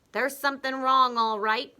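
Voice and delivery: husband enjoying himself; gloating Mr Twit. Acted. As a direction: savor the trick.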